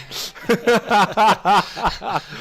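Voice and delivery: loud, high burst of laughter; real open laugh; big laugh. Real, not acted. Laughing at own story.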